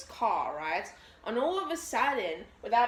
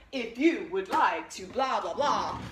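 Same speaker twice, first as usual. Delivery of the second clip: robotic caller imitation. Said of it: "one of those robotic callers like".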